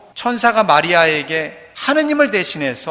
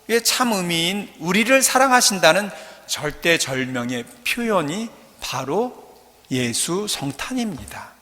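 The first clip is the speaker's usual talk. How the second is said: ordinary talk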